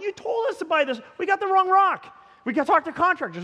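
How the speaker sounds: high, heated retold protest; indignant protest. Pastor retells arguing with the company, mock outrage. Acted argument with rock company.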